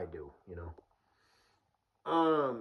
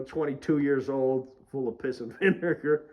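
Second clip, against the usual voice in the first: ordinary talk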